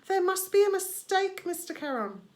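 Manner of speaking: high, loud, whining imitation; mock pleading. Charon mocking begging souls, high loud whine. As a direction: mimic a panicked dead soul. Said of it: "No screaming".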